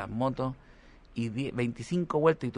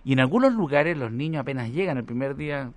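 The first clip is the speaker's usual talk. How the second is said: normal talking voice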